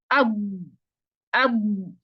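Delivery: अब said slowly, with a held, clear final sound; letter demonstration. Shown as a demonstration. Teacher modelling अब for students, clear and careful. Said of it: "अब जरा आप अदा करके देखें".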